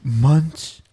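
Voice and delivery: loud shocked question; stunned disbelief. Real, not acted. Reacting shocked to story.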